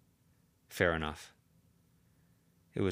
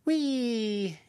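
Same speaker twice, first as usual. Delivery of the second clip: very high, loud gleeful little creature cry; piped-up squeal; 5 dB louder than usual. Acted. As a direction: squeak it out with glee. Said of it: "Cherry piped up".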